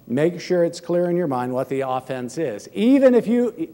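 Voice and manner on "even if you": emphatic. Acted. Sudden emphatic restart.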